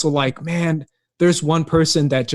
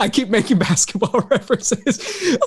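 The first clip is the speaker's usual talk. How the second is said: laughing through the words; 7 semitones higher than usual. Real, not acted. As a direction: giggle through it. Laughing at own joke.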